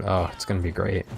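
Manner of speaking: ordinary talk, nothing marked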